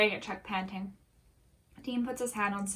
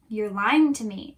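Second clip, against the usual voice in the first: loud accusation, stopping short of a scream; frustrated scream; 12 dB louder than usual. A narrator's reading. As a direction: raise the voice in mild fury. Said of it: "Chuck screams in frustration".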